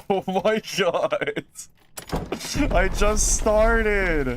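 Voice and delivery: real laughing outcry, words stretched; laughing disbelief. Real, not acted. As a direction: wail it in amused disbelief.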